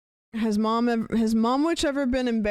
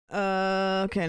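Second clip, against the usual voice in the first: ordinary delivery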